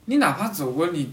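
ordinary delivery

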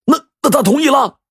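high, stammered question; stunned disbelief. Acted. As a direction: blurt it out, thrown by the news. 徐总惊得差点背过气. (惊得结巴，高声追问)

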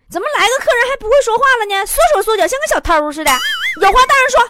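a dad losing patience with his shy son; mock-stern father yelling at the kid; annoyed dad. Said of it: "他爸就不乐意了".

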